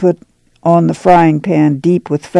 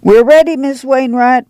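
calling out; 8 semitones higher than usual. A narrator's reading. Ma calls to Miss Wainwright that they are ready. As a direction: call out across the car. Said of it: "Ma called".

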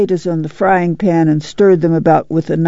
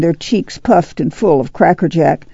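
ordinary delivery

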